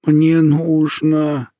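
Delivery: put-on thin, high, very slow old voice; a voice about to break off; frail old woman voice. A narrator's reading. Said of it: "Голос бабушки в темноте казался тонкой-тонкой линией".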